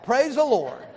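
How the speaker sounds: normal talking voice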